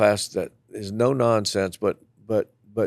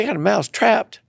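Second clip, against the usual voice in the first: incredulous question; 12 semitones higher, 5 dB louder than usual. Retold or imitated.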